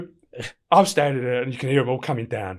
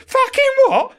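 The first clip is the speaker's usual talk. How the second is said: laughing mock anger; 8 dB louder, slower than usual. Angry prisoner retort, laughing.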